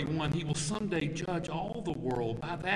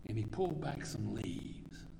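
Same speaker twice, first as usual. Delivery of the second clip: soft, lower, whispered appeal; 6 semitones lower, 10 dB quieter than usual. Acted. Preacher asks the congregation if they hear God, whispering. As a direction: whisper it close to their soul.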